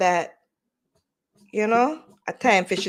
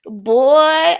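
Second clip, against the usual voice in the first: loud exclaim; 6 semitones higher, 10 dB louder than usual. Real, not acted.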